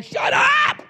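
very high, loud shriek; yelled outburst. Acted. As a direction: yell it like a public outburst. Imagined outburst in a store.

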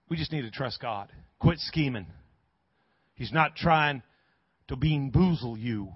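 low, soft closing prayer; hushed pastoral tone; gentle altar call. Real, not acted. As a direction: bring it down quietly, leading into prayer.

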